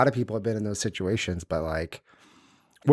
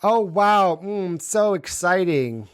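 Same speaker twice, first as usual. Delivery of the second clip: mock excitement; 9 semitones higher, slower than usual. Mocking the guy's invitation.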